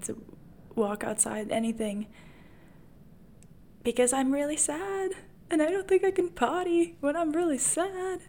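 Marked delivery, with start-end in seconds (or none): 3.8-8.2 high-pitched, self-mocking misery; playfully overdone sadness; mock sad wail. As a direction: wail it higher, like a hammed-up pout. Acts out her own sad meltdown.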